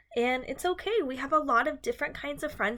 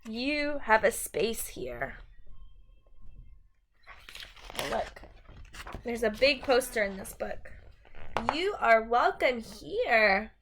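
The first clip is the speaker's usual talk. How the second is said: bright, sing-song picture book reading; reading to children; 3 semitones higher, 5 dB louder, slower than usual. Acted. Teacher reading a rhyming book to her class, sing-song. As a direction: read the rhyme to a class, lilting and lively.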